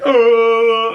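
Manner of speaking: loud, high drawn-out "oh"; mock diving yell. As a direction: imitate someone flung from a blast. Imitating actors diving from explosion.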